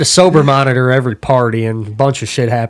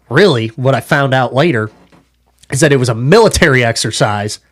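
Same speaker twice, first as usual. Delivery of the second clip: usual speaking voice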